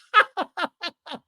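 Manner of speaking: high imitation cackle; bursting laugh. Onlooker bursts out laughing mid-meal, imitated high cackle. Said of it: "literally just bursts out laughing".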